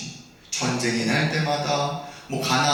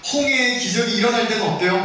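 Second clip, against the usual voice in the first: normal talking voice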